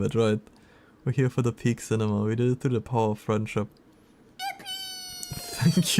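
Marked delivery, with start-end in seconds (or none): 4.4-6.0 very high squeak of joy; excited squeal. Real, not acted. Streamer squeals thanks at a new follower. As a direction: squeal it as high as you can.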